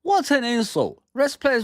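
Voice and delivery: real indignation at a reporter's question; outraged. Real, not acted. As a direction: spit it out like you've been insulted. Reacting to press question.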